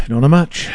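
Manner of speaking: sighed, easy "Don't know much" rising on "much"; right inflection. Shown as a demonstration. Drilled on the greeting, a sighed casual "Don't know much". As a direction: sigh it out, casual. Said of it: "That was the right inflection".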